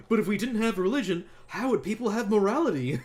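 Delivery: high, loud mocking parody; mock the preachy argument. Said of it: "I'm so sick of hearing this argument from people".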